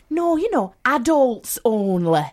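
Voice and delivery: "adults only" slowed and leaned on hard; stress on adults only. Retold or imitated. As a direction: drag out "adults only" with weight. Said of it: "she kept stressing the adults only".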